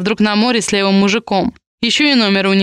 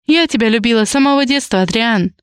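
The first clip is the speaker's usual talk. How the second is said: ordinary delivery, nothing marked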